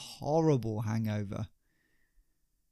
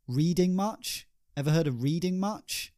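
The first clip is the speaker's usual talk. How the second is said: sarcastic jab; 6 semitones higher than usual. Acted. Mocking rhetorical question.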